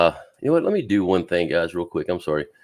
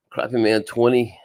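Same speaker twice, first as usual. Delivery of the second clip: ordinary talk, nothing marked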